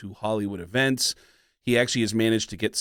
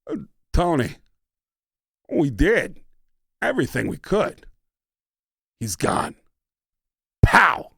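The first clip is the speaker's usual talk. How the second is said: higher, halting Walken read with odd pauses; Christopher Walken impression; 3 semitones higher than usual. Redoing the audition line as her husband, halting Walken cadence. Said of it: "one more time as my husband".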